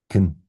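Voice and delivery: "can" reduced to a short schwa, "kn"; unstressed "can". Shown as a demonstration. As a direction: say "can" weakly, vowel swallowed to schwa. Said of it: "That's unstressed".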